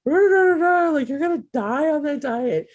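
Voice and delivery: high-pitched, exaggerated panic as you mimic alarmed critics; mock freak-out. People reacting to her going vegan, mock freak-out. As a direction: imitate people freaking out, voice shooting up. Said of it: "everybody freaked out".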